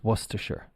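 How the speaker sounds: "Worcestershire" as WUSS-tuh-shur; correct pronunciation. Shown as a demonstration. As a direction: say "Worcestershire" shortened, ending in "shur". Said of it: "that's the word that's pronounced".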